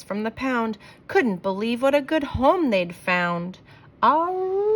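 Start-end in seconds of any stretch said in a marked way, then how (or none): 4.0-4.7 short, high howl; dog howl. A narrator's reading. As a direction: yelp out a quick hound howl. Dogs howl happily.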